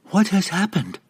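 high, urgent demand. A narrator's reading. Lady alarmed by bad news, demanding. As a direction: press sharply for the answer. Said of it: "Illustrial demanded".

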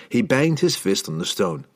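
ordinary delivery, nothing marked

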